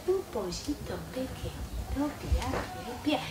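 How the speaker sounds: the wrong way, barely there; too soft. Shown as a demonstration. Teacher shows the overly soft way she avoids. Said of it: "tampoco lo hago".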